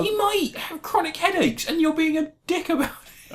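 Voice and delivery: very high, squeaky scolding; mock indignant squeal. Defending the actor against a co-host's teasing, mock indignant squeal. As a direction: protest in a hammed-up falsetto.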